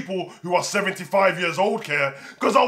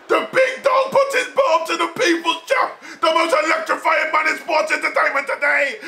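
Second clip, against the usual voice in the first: emotional hype; 5 semitones higher than usual. Real, not acted. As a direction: announce it like a moved ringside fan. Hyping the big face-off.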